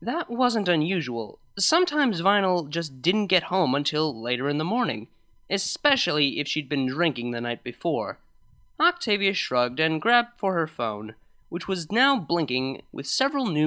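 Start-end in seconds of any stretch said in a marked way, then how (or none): none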